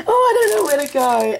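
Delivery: high, teary laugh into words; overwhelmed and giggling, near crying; laughing through tears. Real, not acted. Overwhelmed by gifts.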